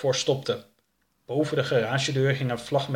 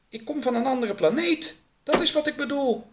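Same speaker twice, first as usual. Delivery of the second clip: much higher character voice; earnest alien; 14 semitones higher than usual. Acted. Oroka to his questioner about his planet, high insistence.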